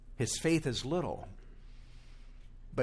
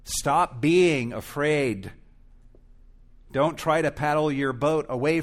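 urgent command; 3 semitones higher, 6 dB louder than usual. Acted. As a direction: plead with them to stop fearing. Preacher urging listeners not to fear.

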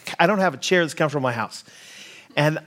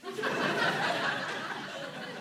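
hushed giggle; 11 dB quieter than usual. Real, not acted. Stifled laugh in plane seat.

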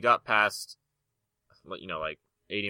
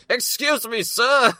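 high, polite mock gentleman; posh buyer voice; 11 dB louder, slower than usual. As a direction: play an overly polite buyer. Mock buying a house skit.

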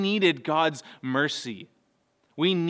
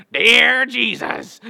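loud, drawn-out, high weird voice; belted out slowly in a silly put-on voice; weird kid's prayer voice; 7 semitones higher, 10 dB louder, much slower than usual. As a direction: imitate a small boy's weird, Southern-sounding prayer voice. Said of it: "took on this really weird voice".

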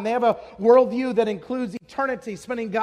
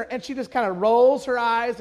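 ordinary delivery

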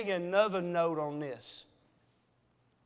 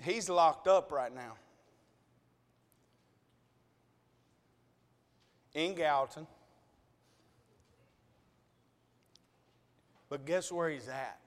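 emotional; 7 semitones lower, 5 dB quieter than usual. Real, not acted. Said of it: "I'm emotional, y'all".

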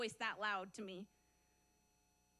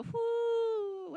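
long, high, held whoo; airy whoop; 8 semitones higher, 5 dB louder than usual. Retold or imitated. Church members drifting with the wind, mock blissful whoop. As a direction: let out a mock carefree whoo.

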